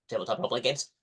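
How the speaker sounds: rushed, embarrassed mumble; quick sheepish mumble; mumbled quickly. Retold or imitated. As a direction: hurry the words out, a bit ashamed. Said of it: "you know, like, really quickly".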